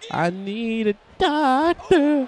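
high, drawn-out cry for a doctor; mock plea. Wrestler begging for a doctor, mock plea. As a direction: stretch it out in a high mock wail. Game character begs for doctor.

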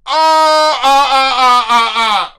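high, loud repeated ah, falling away; disgusted shriek fading. Real, not acted. Streamer shrieking in disgust at a dono, fading. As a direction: shriek in disgust then fade.

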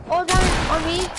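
loud excited callout; hyped gamer bro. Real, not acted. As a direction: yell it to your squad. Calling out to teammate, loud.

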